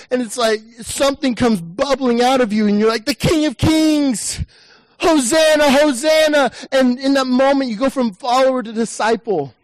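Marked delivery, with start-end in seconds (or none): 3.1-6.5 higher, slow, swelling cry of praise; worshipful cry. Acted. Preacher acting out a disciple's cry of praise, higher and slow. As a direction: let the praise burst out of you. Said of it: "And there's a cry".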